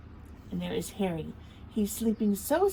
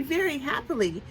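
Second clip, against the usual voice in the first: plain reading voice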